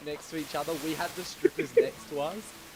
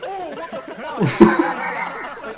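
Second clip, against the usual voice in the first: laughing, higher, reliving the shock; 10 semitones higher, 14 dB louder, much slower than usual. Real, not acted. Telling friends about the private dance, laughing in disbelief. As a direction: gasp it out through laughter.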